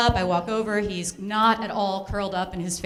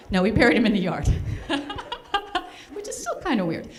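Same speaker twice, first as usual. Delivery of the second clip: ordinary talk, nothing marked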